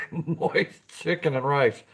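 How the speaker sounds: stifled giggle through the words; slow, trying not to laugh at moist; giggling. Real, not acted. Laughing at the word on his own stream. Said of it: "I can't even say the word without giggling".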